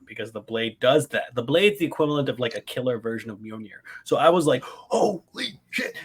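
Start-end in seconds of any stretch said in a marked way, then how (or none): 4.6-6.0 drawn-out high awestruck gasp; retold fanboy freak-out, slow and high. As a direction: stretch it slowly, pitched way up in amazement. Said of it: "I was, like, freaking out".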